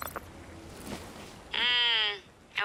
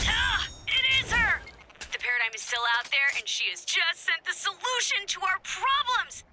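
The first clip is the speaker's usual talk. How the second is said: high, excited cry of discovery; thrilled outburst; 10 semitones higher than usual. Acted. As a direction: burst out with elated triumph. Character gets thrilling discovery.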